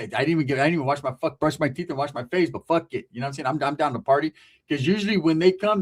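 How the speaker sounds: ordinary talk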